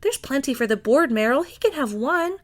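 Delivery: indulgent. A narrator's reading. Millie indulgent.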